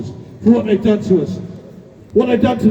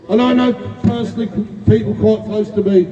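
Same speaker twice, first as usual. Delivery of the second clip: ordinary talk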